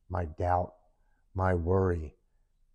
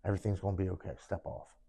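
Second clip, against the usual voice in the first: luring inner voice; 8 dB quieter, faster than usual. Retold or imitated. Voice in his head urging him off the bucket, hushed coaxing. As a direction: urge very softly, trailing almost to nothing. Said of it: "and it says".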